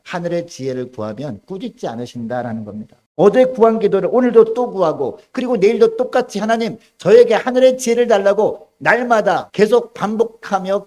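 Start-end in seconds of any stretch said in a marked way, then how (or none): 3.2-10.9 loud, high emphatic preaching; fervent preaching. Acted. Preacher urging the congregation to keep praying, fervent and loud. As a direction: drive home the point about praying every day. Preacher stresses repeated daily prayer.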